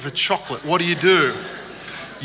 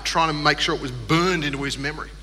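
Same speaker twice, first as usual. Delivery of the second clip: ordinary talk, nothing marked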